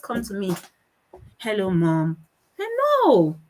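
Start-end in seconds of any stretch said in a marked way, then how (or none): 1.4-2.2 put-on unenthusiastic child greeting. Acts daughter's unenthusiastic greeting. 2.6-3.4 protesting mother. Retold or imitated. Mother rejects the tame greeting.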